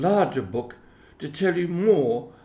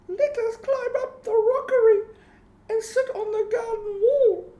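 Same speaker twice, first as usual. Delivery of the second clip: much higher, playful little kitten. A narrator's reading. Moppet urging her siblings up the wall, high kitten voice. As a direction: squeak it like an eager kitten. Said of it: "said Moppet".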